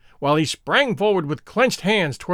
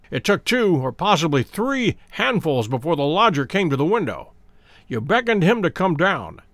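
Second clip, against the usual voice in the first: ordinary delivery, nothing marked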